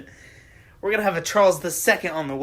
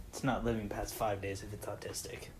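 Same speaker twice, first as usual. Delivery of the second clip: much lower, quiet deadpan; 9 semitones lower, 12 dB quieter than usual. Real, not acted. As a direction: drop the voice, dead serious. Dark joke aside.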